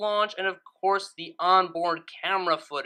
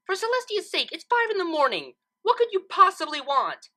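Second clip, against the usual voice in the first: much higher, louder, fed-up outburst; exasperated yell; 12 semitones higher, 4 dB louder than usual. A narrator's reading. Twilight yelling from her window at the early visitor, exasperated. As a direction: woken at five and furious about it. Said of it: "she yelled".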